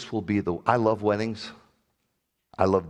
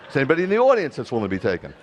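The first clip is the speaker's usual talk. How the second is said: fast, louder hammed-up officiant plea; joking rush; 3 semitones higher, 6 dB louder, much faster than usual. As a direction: rattle it off like a desperate officiant. Mock officiant asks audience, joking.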